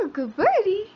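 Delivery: high, sing-song praise for a pet. Real, not acted. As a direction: praise the bird sweetly. Praising the bird.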